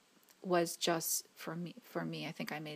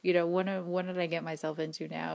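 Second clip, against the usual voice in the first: laughing exasperation; 4 dB louder than usual. Real, not acted. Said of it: "is what I'm thinking".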